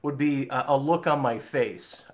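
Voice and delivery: ordinary delivery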